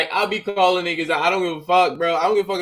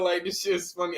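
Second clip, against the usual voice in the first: usual speaking voice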